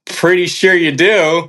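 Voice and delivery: high, drawn-out retort; pointed disbelief. Retold or imitated. Hiker answering man who claimed no disrespect, pointedly sceptical. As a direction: push back slowly, pitched well up, sceptical.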